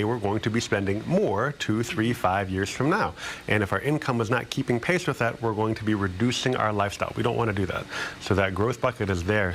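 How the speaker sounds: normal talking voice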